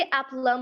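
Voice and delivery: "एप्लम" closing on a plain m; silent B. Shown as a demonstration. Teacher repeating the word for learners, "एप्लम" without any b. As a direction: repeat "एप्लम" as ap-lum, dropping the B. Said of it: "B that is going to be silent".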